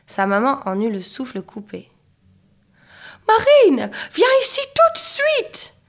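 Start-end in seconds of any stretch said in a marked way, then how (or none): 3.3-5.8 very high, loud motherly yell; yelled order. A narrator's reading. Breathless mother orders Marine down, high yell. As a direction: shriek the order, drawing out the end. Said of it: "hurla-t-elle".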